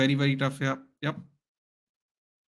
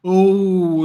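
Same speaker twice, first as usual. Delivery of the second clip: louder, higher gasp of surprise; surprised oh; 4 semitones higher, 9 dB louder than usual. Real, not acted. Fan gasping at Bruno's near steal, loud surprised oh.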